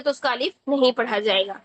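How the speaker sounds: ordinary talk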